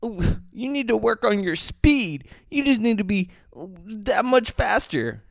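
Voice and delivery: high mock-sweet voice of the lady; snooty coach-like lady, mocked. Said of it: "some lady is like".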